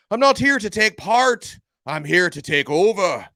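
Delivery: high, loud, hammed-up Irish accent quoting Conor McGregor; terrible Irish accent. Podcast host quoting Conor McGregor about hungry freshmen, bad Irish accent. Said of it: "that was a terrible Irish accent".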